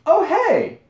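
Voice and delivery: bright, high surprised hello; cheery greeting. Retold or imitated. Girl at the store spots Alex, high cheery greeting. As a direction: greet him like an old friend you just spotted. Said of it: "she looks at me and she goes".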